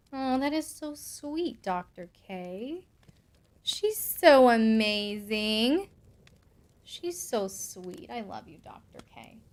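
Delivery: soft, slow and sincere; hushed, affectionate thanks; touched, tender. Real, not acted. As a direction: let it sink in, very softly and slowly. Touched by a kind message.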